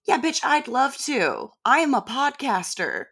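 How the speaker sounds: a sarcastic yes to an absurd suggestion; sarcastic mock enthusiasm. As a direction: gush sarcastically as if you'd love to. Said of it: "and being like".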